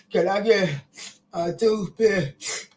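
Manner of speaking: slow, lisping gap-toothed voice; toothless lisp. Retold or imitated. Showing how she talks without front teeth, a slow lisp. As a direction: lisp it out like your front teeth are missing. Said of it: "I talk like this".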